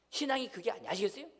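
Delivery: normal talking voice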